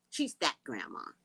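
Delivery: ordinary delivery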